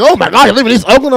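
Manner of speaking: high, loud, rapid mock shock; mock alarm. Everyone hearing he lives in East Oakland, mock alarm. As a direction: rush it out like horrified friends. Others react to where he lives.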